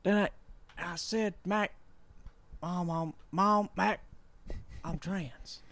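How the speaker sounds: Joe Biden. Retold or imitated. Politician coming out to Mom, halting high impression. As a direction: stammer out a confession in mock voice. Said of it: "then it cuts to twenty nineteen".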